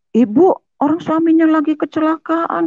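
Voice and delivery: high-pitched, scandalised bystander; retold shocked reproach. Neighbour rebukes wife over husband's accident, retold shock. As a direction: reproach her in disbelief.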